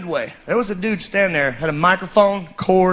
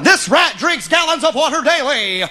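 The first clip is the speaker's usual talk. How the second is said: hammed-up midway barker shouting the pitch; barker spiel; 4 semitones higher than usual. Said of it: "And he kept going".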